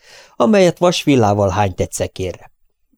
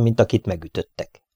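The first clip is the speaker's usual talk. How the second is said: ordinary delivery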